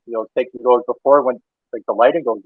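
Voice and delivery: ordinary delivery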